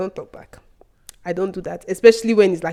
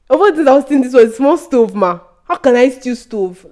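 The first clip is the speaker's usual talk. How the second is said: loud, high indignant retort; talking back; 5 semitones higher, 6 dB louder than usual. Retold or imitated. Student answering teacher's theft accusation, loud outrage. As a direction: push out the protest with raised voice. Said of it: "when I talked back at her".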